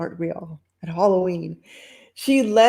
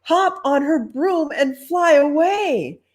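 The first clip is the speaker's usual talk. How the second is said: shocked lady; 10 semitones higher, 7 dB louder than usual. Acted.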